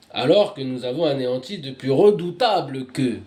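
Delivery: loud, emphatic verse reading; declaimed scripture reading. Real, not acted. Reader proclaiming the annihilation of the mighty, loud declamation. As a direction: proclaim it, punching the key words.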